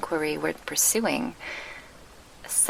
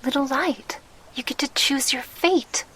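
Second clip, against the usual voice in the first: joyful announcement; 8 semitones higher, 3 dB louder than usual. Acted. Veda tells the little light.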